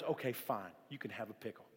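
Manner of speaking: imitated exasperated dad relenting; giving in. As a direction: drop the voice in weary surrender. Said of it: "kept just driving my dad crazy".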